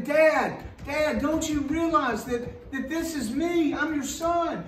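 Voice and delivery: a son begging his father not to turn away; pleading son. Retold or imitated. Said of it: "It's like he's saying".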